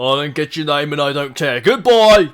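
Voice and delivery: loud dismissive guard shout. Acted. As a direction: bark a rude goodbye. NPC guard dismisses player.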